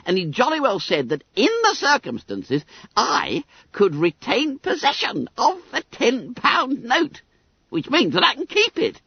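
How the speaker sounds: high, squeaky schoolboy voice; self-satisfied Billy Bunter. A narrator's reading. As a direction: pipe it up high, full of importance.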